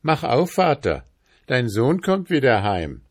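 high, loud call at the door. A narrator's reading. Prince calls to his father, high loud call. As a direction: call up to your father to open. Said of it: "rief der Prinz".

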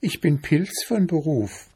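high, friendly little mushroom; friendly squeaky voice. A narrator's reading. Chanterelle introducing himself to the bear, friendly high voice. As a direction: answer amiably in a small high voice. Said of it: "sagte der Pfifferling freundlich".

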